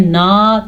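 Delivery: "نا" with a long dragged vowel; wrongly lengthened vowel. Shown as a demonstration. Teacher warning learners against lengthening, "نا" held too long. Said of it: "تھوڑا سا بھی لمبا کر دو غلط ہے".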